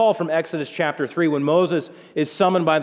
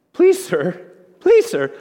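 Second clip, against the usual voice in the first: high, loud, panicky pleading; voice cracking higher; 4 semitones higher, 7 dB louder, slower than usual. Retold or imitated. Frightened Gideon pleading with the angel, voice jumping up. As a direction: beg like a terrified young man. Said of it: "I bet his voice hit another octave".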